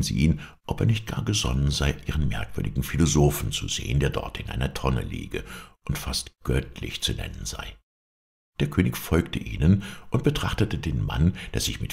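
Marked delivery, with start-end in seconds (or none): none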